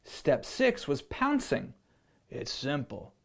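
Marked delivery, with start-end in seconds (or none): 2.3-3.1 lowered lion teacher voice; a low, matter-of-fact lion. Acted. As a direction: speak it down low like a calm lion. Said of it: "said the lion".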